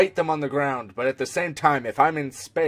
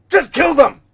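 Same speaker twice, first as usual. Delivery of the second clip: loud high command to kill; shouting; 10 semitones higher, 9 dB louder than usual. Acted. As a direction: bark the order like a tyrant. Mock Emperor order.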